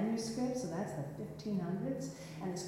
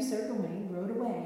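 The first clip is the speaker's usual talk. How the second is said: ordinary delivery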